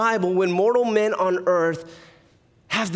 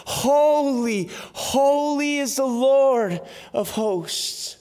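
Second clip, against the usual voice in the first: slow, ringing proclamation of holy; slow proclaimed holy, holy, holy; proclaimed praise; 4 semitones higher, much slower than usual. Acted. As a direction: cry it out like the angels in worship. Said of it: "and cry out".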